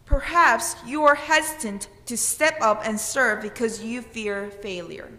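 ordinary delivery

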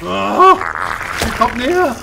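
being chased. Real, not acted. Enemy close, tense flight.

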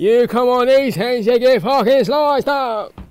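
hammed-up British, stretched out; British accent. Doing a British accent on camera for his friend. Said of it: "Do a British accent for the rest of the video".

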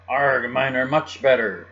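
drawn-out boastful pirate yell. A narrator's reading. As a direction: bellow back like a rival pirate. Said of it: "yelled the other".

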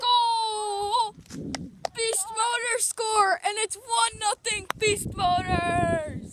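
long drawn-out goal shout; excited goal call. Pretend. Announcer tells viewers Beast Motors scored, drawn-out excited call. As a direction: stretch the words out like a match commentator.

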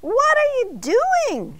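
high, drawn-out alarmed shout; loud, stretched cry of protest. A narrator's reading. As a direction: shout it in outrage, pitch way up. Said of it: "shouted Arnie".

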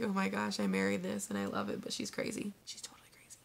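mock husband muttering fondly; calm amused husband. Imitating her husband shaking his head at his wife. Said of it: "shakes his head and smiles at me like".

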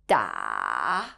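DA dropping very low, then back up; long DA that sinks down and lifts at the end; dipping tone. Shown as a demonstration. Teacher showing Mandarin learners the third tone, dipping. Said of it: "by falling down first before going back up".

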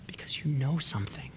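a near-silent whisper to the flock; hushed whisper. Real, not acted. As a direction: drop to an intimate whisper. Confiding the secret of stillness.

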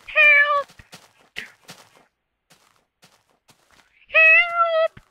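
very high, loud falsetto cries; squeaky mob voice. Pretend. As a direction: shriek it like a tiny panicked creature.

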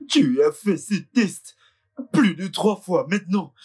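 slow, deliberate disbelief; slow distressed plea. Acted. Husband pleads with the family doctor, slowly. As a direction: spell out each word in shock.

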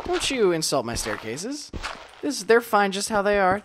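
usual speaking voice

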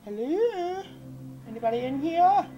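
high, loud sing-song hello into the dark; mock falsetto call. Calling out into empty room.

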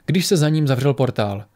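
ordinary delivery

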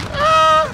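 sudden high yell; scream. Real, not acted. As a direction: shriek in frustration. Screams after getting killed.

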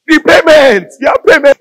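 high, loud taunting devil; devil's shout. Acted. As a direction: yell it up high to rattle him.

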